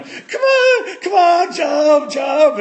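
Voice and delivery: high, loud taunting cheer from the sidelines; kids cheering. Retold or imitated. Friends on the sidelines urge scared Ricky to jump, high and loud. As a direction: egg him on like impatient kids. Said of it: "friends are standing on the sidelines going".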